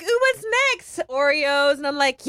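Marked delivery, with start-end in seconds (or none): 0.0-1.0 much higher, eager ooh; gleeful snack hunt; excited. Retold or imitated.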